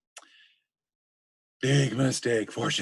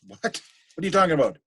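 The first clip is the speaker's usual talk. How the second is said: surprised Hamlet; 6 semitones higher, 5 dB louder than usual. Retold or imitated. Hamlet hears of ghost.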